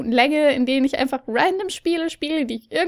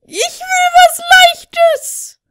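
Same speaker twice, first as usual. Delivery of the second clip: high, slow whine; whining; 7 dB louder, much slower than usual. Real, not acted. Whining for easy mode.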